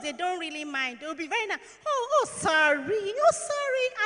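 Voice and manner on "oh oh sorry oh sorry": higher, slow, drawn-out mock sympathy; imitating sympathetic foreigners. Speaker mimics foreigners comforting a stained girl, high slow mock sympathy. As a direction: coo "Oh, sorry" like a kind stranger, stretched out and high. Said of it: "So the Oyimbos".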